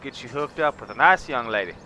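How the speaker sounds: loud, hammed-up southern accent like a ranch hand; southern ranch talk. Gamer inviting viewers down south, loud mock southern accent. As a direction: lay on a big Southern accent, welcoming a partner. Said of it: "I could go all southern on you".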